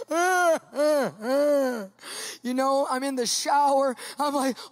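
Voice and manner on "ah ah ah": repeated comic wailing "ah"; mock-pitiful moans; cowardly lion whimper. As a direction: whimper in short frightened bursts. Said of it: "like the cowardly lion".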